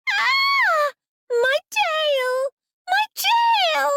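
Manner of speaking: very high, loud squealing creature; a little animal shrieking in pain; screaming small beast. Acted. Small forest beast stepped on, screaming about its tail. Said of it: "screaming at full volume".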